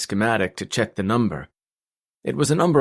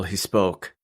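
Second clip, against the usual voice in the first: ordinary delivery, nothing marked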